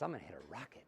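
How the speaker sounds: low, soft, certain; low confident vow. Shown as a demonstration. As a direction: say it quietly like a promise.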